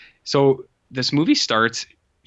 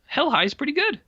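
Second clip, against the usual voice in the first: much higher, delighted voice of discovery; pleasantly surprised. Retold or imitated. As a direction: squeal the verdict like a happy surprise. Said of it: "pleasantly surprised by this".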